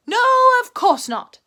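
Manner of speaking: high, loud cheery greeting; Tigger calling out. A narrator's reading. Tigger greeting Pooh and Piglet, loud cheery call. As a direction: call out like a bouncy tiger.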